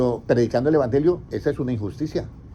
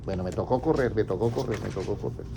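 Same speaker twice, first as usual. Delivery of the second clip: low, soft aside to oneself; muttered aside; 6 semitones lower, 7 dB quieter than usual. Real, not acted. Preacher to self while finding the verse, muttered aside. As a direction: say it under your breath, trailing softer.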